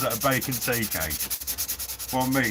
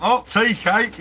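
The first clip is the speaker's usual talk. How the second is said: surprised; 9 semitones higher, 6 dB louder, slower than usual. Real, not acted. Reacting to chat name for bun.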